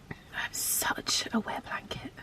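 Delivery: slow whisper, embarrassed by tears; tearful whisper. Real, not acted. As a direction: whisper it, laughing at yourself through tears. Teary after comforting dog.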